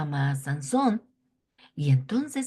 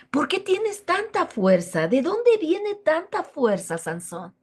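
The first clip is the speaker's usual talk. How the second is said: higher, louder accusing outburst; angry demand; 4 semitones higher, 4 dB louder than usual. A narrator's reading. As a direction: scold him for the trick. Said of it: "se enoja demasiado".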